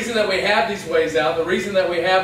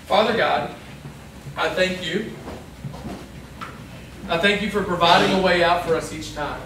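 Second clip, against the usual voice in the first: prayer. Acted. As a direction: lead the room into prayer, gently. Leads the closing prayer.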